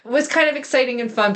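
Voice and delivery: usual speaking voice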